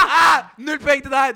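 indignant shout. Real, not acted. Host shouting at his co-host, denying him the point, laughing. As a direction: yell the verdict through laughter. Indignant outburst at wrong answer.

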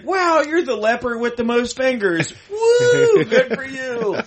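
high mock whooping cheer, half crying. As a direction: cheer him on sarcastically with a whoop. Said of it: "it's like".

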